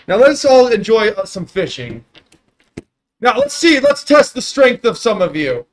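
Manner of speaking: loud, high jovial giant; dopey cartoon bellow. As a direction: boom it like a cheerful giant. GM voicing giant.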